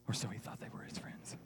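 put-on hushed aside to the kids; conspiratorial whisper. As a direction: whisper it as a wry side note. Wry aside in story.

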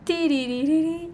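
drawn-out, high-pitched "tiriririri"; sing-song imitation of a sound, stretched slowly; imitated trill. Telling how she felt at the play, imitated trill. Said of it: "y así el".

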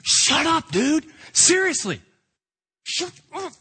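a hint of irritated disciples scolding; annoyed shushing. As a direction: tell the beggar off sharply. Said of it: "the whole people are going".

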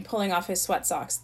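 plain reading voice

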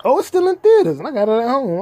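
high, loud burst of surprise; sudden high surprise; surprised realisation. Real, not acted. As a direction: catch yourself, startled that it's still out. Realises the movie is still in theaters.

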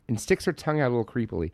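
ordinary talk, nothing marked

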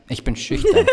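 high giggling coy voice; giggly late night call. Pretend. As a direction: squeal it shyly through laughter. Mock coy lover on phone.

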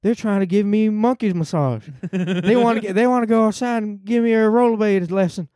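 high mock Biden rant about soup; Joe Biden impression. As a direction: scold the voter like Biden at a town hall. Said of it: "a solid Joe Biden".

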